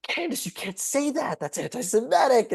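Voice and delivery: high whiny protest; mock outraged rabbi. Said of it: "was like".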